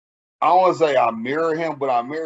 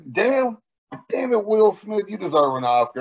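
mock choked-up praise; 9 semitones higher than usual. Telling cohost how the Will Smith trailer moved him, mock teary gush. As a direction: ham up being moved to tears. I can't stop fucking crying.

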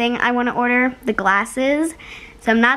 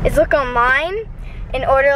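usual speaking voice

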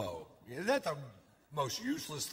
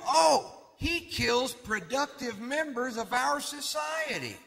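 slow, higher, louder answer; mock shout; 9 semitones higher, 4 dB louder, slower than usual. Answering the court about the killer, slow loud shout. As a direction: drag it out, shouting.

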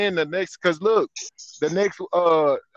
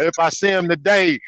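usual speaking voice

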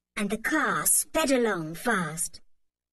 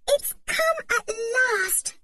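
high, slow, trembling with delight; breathless joy; 4 dB louder, slower than usual. A narrator's reading. Anne greets the holiday morning, trembling joy. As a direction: savour the moment.

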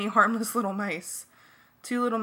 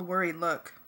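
ordinary delivery

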